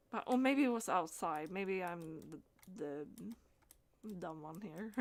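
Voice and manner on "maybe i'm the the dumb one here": soft self-mocking aside under the breath; quietly embarrassed at own mix-up; sheepish mutter. Real, not acted. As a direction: drop your voice and tease yourself. Self-deprecating aside while searching.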